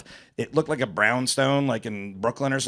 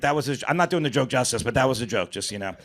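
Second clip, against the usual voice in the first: usual speaking voice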